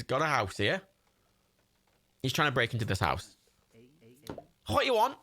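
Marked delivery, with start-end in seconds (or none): 4.7-5.2 high Scouse challenge; Scouse Jimmy. Acted. Scrapper challenging a visitor at the house, put-on Scouse. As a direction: pitch it up in a put-on Scouse accent. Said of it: "so Scouse Jimmy".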